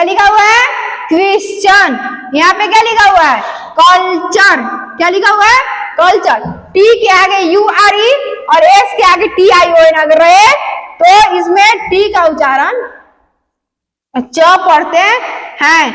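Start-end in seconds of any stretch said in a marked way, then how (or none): none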